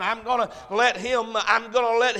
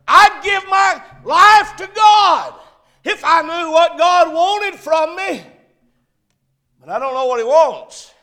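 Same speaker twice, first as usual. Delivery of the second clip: pleading protest; 6 semitones higher, 9 dB louder than usual. Acted. Preacher voicing doubting believers.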